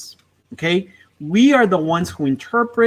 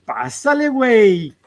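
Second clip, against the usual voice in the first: playful; 5 semitones higher than usual. Shown as a demonstration. Playful phrase for kids.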